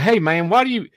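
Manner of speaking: imitating a loud Southern guy; thick Southern accent. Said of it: "he had a very thick Southern accent".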